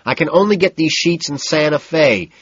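high and loud, overriding her. A narrator's reading. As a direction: push the words over her babble. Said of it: "and then louder, still over her".

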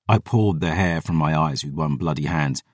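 plain reading voice

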